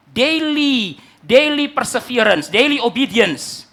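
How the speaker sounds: loud, high, slow slogan; emphatic preaching. Acted. Preacher urging congregation to daily obedience, emphatic preaching. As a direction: proclaim each phrase, stretched out and forceful.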